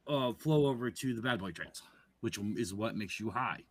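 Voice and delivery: ordinary delivery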